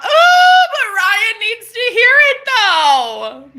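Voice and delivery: high, loud squeal, drawn out; excited outburst. Real, not acted. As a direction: burst out in a high, stretched holler. Excited outburst at viewer comment.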